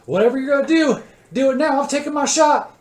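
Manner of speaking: high, full-force shout; urgent yell. Acted. Figure yelling to an ally while taking the shot, urgent high shout. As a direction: yell it urgently over the action. Figure urges action as he shoots.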